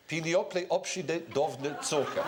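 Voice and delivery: "Piniople opshide dovne tsoka" slow, with Hungarian vowels; grandfather's accented cake name; Hungarian mispronunciation. Retold or imitated. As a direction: mangle pineapple upside down cake the grandfather's way. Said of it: "He used to s-, pronounce".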